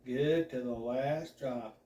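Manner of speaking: slow, low, smooth announcer purr; silky slow slogan; super smooth. Acted. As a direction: ease it out like a late-night radio host. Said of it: "I say super smoothly".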